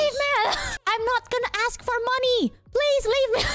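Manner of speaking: very high, frantic mock plea; about to cry. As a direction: beg in a hammed-up near-tearful wail. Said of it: "I was, like, about to cry".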